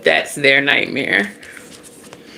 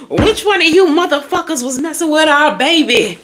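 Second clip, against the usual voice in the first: furious ancestors; 11 semitones higher than usual. Acted. Devilish ancestors hunting whoever hurt their baby, high mock fury. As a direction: demand who messed with the baby. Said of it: "They like".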